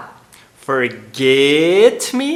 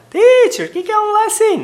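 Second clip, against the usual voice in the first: pretend student; 4 dB louder than usual. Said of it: "student question".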